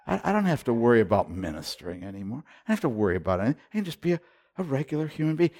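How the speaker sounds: fast, light, tumbling out; relieved rush. Retold or imitated. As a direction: rattle it off with relief.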